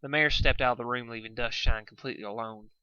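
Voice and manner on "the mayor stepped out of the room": ordinary delivery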